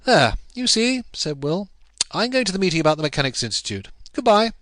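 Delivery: lightly voiced young man rushing away; Will hurrying off. A narrator's reading. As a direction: dash out with a quick goodbye. Will hurries out.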